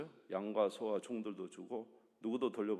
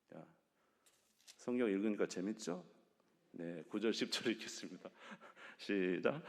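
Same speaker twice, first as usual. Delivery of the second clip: ordinary talk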